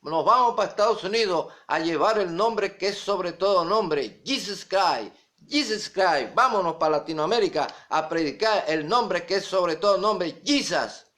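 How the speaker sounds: imagine missionaries rallying each other to go preach; zealous missionaries. Acted. Preacher imagining trinitarians setting off for Latin America, mock missionary zeal. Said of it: "A lo mejor dijeron".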